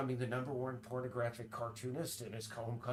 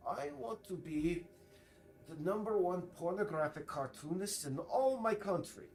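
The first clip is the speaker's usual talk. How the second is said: bad Icelandic accent; 6 semitones higher, 3 dB louder than usual. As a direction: parody an Icelander's accent, pitched up. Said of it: "I do the worst Icelandic accent".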